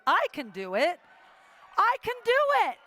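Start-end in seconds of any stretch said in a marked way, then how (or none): 1.8-2.8 much higher, fast, loud hype; rallying her own courage; pumped-up cheer. Retold or imitated. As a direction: psych yourself up like a cheerleader. Self pep talk retold.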